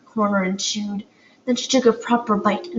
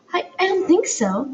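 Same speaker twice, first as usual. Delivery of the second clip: stammering; 9 semitones higher than usual. A narrator's reading. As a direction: trip over the first word nervously. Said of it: "Poppy stammered".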